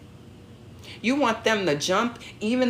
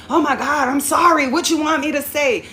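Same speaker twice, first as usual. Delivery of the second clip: much higher, louder mock-exasperated sorry; exasperated apology; 9 semitones higher, 8 dB louder than usual. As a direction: throw out the sorry in irritation. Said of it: "in aggravation or frustration, you have said".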